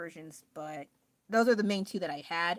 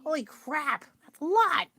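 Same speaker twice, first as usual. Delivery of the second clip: high, loud incredulous gasp at the number; stunned, voice jumping up high; shocked disbelief; 8 semitones higher, 3 dB louder, slower than usual. Retold or imitated. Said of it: "and I was like".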